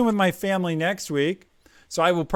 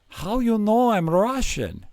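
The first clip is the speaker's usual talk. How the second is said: higher-pitched mock Russian accent; 5 semitones higher than usual. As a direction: quote the carpenter in Russian-accented English. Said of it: "I imitate Russians speaking English really badly".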